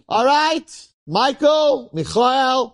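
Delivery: high, ringing drawn-out call; summoning shout. Acted. Heavenly judge summoning Michael's mitzvahs to come out, calling out.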